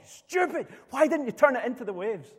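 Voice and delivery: high, loud furious yell at a careless crewman; furious shout. Retold or imitated. Skipper screaming at Greg after the dinghy tips, furious shout. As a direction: bawl it out like a skipper who just lost his temper. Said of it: "just shouting and bawling".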